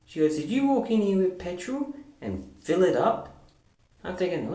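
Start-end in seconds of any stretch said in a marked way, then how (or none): 0.4-3.3 much higher, pressing accusation; mock angry accusation. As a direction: demand an answer, outraged. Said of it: "went off her rockers at me".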